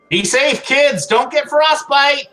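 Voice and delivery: high, loud mock holler; calling out. [name] to kids from an open window, calling out.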